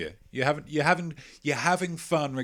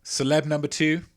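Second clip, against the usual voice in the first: normal talking voice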